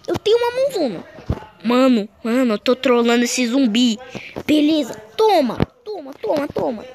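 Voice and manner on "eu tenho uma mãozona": high amazed squeal; surprised squeal. Real, not acted.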